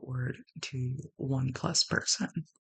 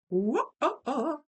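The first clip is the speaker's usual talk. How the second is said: excited whoa; 10 semitones higher, 7 dB louder than usual. Real, not acted.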